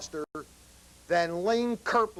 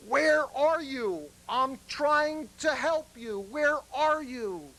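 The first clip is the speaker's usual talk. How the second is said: higher, drawn-out calling out; yelling out; 7 semitones higher, slower than usual. Acted. Husband calling out to the drunk he can't see, yelling out. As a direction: call slowly into the dark, pitched up. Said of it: "So the husband yells out to the drunk".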